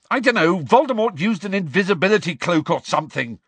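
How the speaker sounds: loud, high, exasperated retort; shouting. A narrator's reading. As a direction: shout back, losing patience. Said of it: "Harry shouted".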